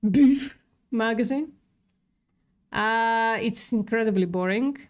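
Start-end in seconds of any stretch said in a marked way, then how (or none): 0.1-1.5 BEEF punched high and loud; forceful stress on BEEF; assertive BEEF. Shown as a demonstration. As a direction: assert BEEF firmly before magazine. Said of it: "you know, assert it".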